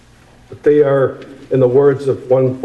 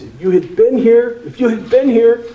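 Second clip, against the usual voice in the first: higher pitched, pleading accusation raised at Jesus; accusing repeat; 10 semitones higher, 3 dB louder than usual. Acted. Grieving sister accusing Jesus of coming late. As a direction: let the complaint rise sharply, twice over. Preacher voices Martha's accusation again.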